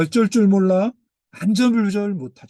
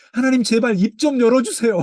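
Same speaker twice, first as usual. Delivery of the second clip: loud, higher desperate begging; bold plea to God; 6 semitones higher, 6 dB louder than usual. Acted. A believer boldly begging the silent God, loud and high. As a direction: demand that God finally speak. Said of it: "이렇게 발칙하게 이야기를 합니다".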